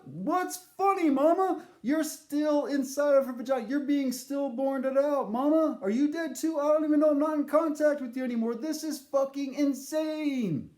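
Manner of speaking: high, loud, incredulous; loud bewildered yell. Retold or imitated. Son yelling at his laughing mother, loud and high. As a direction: shout it in disbelief.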